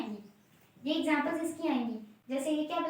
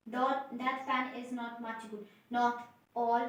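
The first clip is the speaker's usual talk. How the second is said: slow, soft word-by-word recital; 2 semitones lower, 4 dB quieter, much slower than usual. Shown as a demonstration. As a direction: recite it slowly like dictation. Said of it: "reciting the mnemonic". (धीरे और नरम आवाज़ में पढ़कर सुनाएँ)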